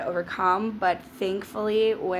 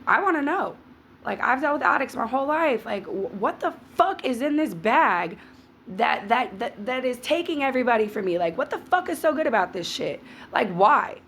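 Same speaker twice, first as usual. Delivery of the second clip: raised, heated demand at the mirror; fired-up rant at the bag, higher pitched; retold angry outburst; 5 semitones higher than usual. Alone at the mirror, cursing the drugs, in a heated outburst. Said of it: "I was pissed".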